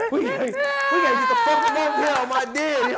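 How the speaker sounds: high, loud urgent yelling; panicked call. Acted. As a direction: yell it like they're about to get caught.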